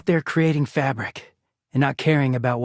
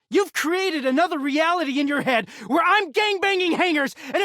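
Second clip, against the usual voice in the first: screamed rant pitched far up; furious shouting. Acted. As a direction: scream it at the counselor, beside yourself with rage. Partner yelling at the counselor.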